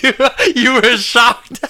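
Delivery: high, loud, squeezed out through laughter; words breaking into real high-pitched laughing; helpless laughter. Real, not acted. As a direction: tease someone while cracking up. Laughing at co-host's shocked face.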